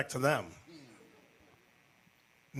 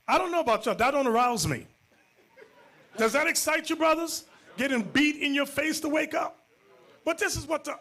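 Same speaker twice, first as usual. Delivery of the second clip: loud and high, incredulous; preacher shouting at the brothers; outraged shout; 11 semitones higher, 4 dB louder than usual. Real, not acted. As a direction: challenge the brothers loudly. Preacher challenging the congregation.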